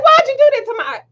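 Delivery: high-pitched exasperated question; retold scolding. Mother asking why child called police, exasperated. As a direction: demand it way up high, in retelling.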